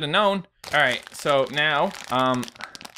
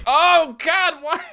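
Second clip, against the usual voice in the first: high loud oh God; anguished cry; 12 semitones higher, 6 dB louder, much slower than usual. Real, not acted. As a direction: cry out to the sky. Clothespins falling off.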